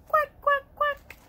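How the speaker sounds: high, loud duck quacks; happy duck quacking. Acted. Ducky showing children how happy sounds, high quacking. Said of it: "When I'm feeling happy, I say".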